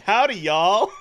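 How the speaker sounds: loud, hammed-up Southern greeting; Southern Paula Deen twang. Co-host mocks Poe's wrong accent, Southern twang. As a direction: belt it out like a Southern TV cook. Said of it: "went into Paula Deen".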